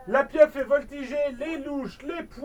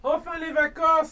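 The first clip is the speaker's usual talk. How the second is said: exclaiming; 5 semitones higher, 4 dB louder than usual. A narrator's reading. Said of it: "s'écrie".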